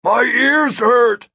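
slow, high-pitched yell; loud-hailing whine of pain; yelled complaint. A narrator's reading. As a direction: call out slowly over blocked ears. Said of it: "Ampix yelled".

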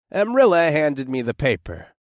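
ordinary delivery

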